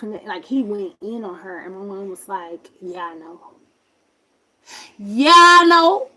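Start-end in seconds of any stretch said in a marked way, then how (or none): 2.8-3.4 subdued reply. Retold or imitated. Mom's reply, first take subdued. 5.0-6.1 high, slow, blaring imitation; loud reply. Mom's reply repeated loudly.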